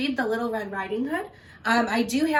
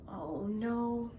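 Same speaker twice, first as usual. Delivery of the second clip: worried aside; 9 dB quieter than usual. A narrator's reading. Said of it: "narrator's scared aside".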